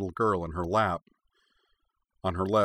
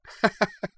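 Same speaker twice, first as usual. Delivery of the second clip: high short laugh; 12 semitones higher than usual. A narrator's reading. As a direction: laugh fondly at the memory. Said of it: "Cora laughed".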